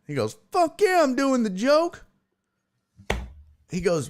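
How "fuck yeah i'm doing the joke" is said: high-pitched, emphatic mock defiance; defiant retort. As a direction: fire back as an imitated gung-ho comic. Comic defiantly insists in retold quote.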